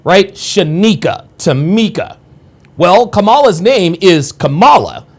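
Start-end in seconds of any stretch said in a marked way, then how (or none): 0.3-2.2 "Shanika, Tamika" each stressed on the middle syllable; "Shanika, Tamika" with NEE and MEE punched; second-syllable stress. Shown as a demonstration. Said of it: "it's always that second one".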